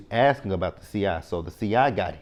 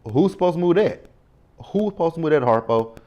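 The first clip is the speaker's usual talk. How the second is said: higher, louder mock panic; mock alarmed reaction; 9 semitones higher, 5 dB louder than usual. Vlogger reacting to the crate of guns, higher and louder. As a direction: play it up like a shocked viewer. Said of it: "I was like".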